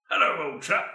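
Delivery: lightly voiced pompous old Englishman; old British man. Host making a formal resolution, mock old British man. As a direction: play a stuffy old British gent. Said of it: "do the old British man".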